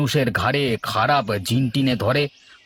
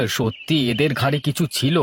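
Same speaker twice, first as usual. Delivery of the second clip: normal talking voice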